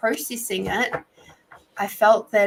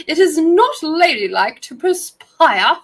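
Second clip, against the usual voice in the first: higher, louder, slow mock-posh lady voice; prim proper lady; 8 semitones higher, 10 dB louder than usual. Teasing a co-worker about sweat, hammed-up proper lady voice. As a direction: lay on the prim lady act thick. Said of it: "You sound so proper when you speak like that".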